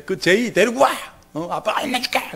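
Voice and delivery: loud, hammed-up dad anger; mock angry threat. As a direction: play the protective dad, half-joking. Father angrily threatening to punish bully.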